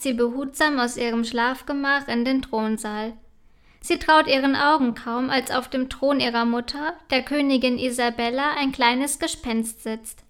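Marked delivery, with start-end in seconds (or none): none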